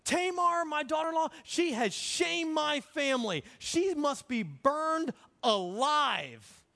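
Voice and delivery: high, loud, acted condemnation; self-righteous outrage. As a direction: pass judgement indignantly, drawing out the sentence. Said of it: "gets real self-righteous".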